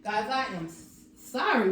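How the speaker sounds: usual speaking voice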